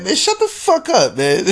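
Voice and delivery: playful Biden impression telling everyone to shut up; mock Biden brush-off. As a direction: toss it off as fed-up Biden, half laughing. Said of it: "Joe Biden's like".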